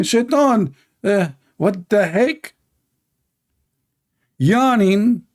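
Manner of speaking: normal talking voice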